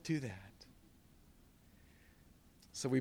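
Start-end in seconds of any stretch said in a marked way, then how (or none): 0.0-0.7 softer, lower echo; quiet repeated plea. Real, not acted. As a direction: repeat it under your breath. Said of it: "I say".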